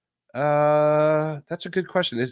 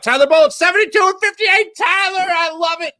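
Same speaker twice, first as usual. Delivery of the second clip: excited shout; 4 dB louder than usual. Real, not acted. Cheering the team record.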